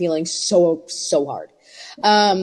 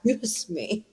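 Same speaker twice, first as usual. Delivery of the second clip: much softer, under-the-breath "Excuse me"; hushed indignant comeback; stunned, quiet retort; 2 semitones higher, 8 dB quieter, slower than usual. Retold or imitated. First stunned retort to son.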